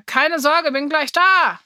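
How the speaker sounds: high, loud reassuring holler; calling out. A narrator's reading. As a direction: shout it across the hall. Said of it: "rief er".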